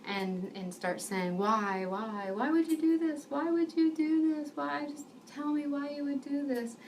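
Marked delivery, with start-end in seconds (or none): 1.4-6.8 imitating a distraught woman, pitched high; mock sobbing plea, high and insistent without real tears; retold tearful pleading. Sobbing woman asks her helper why, retold as high pleading. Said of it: "just sobbing on me, crying".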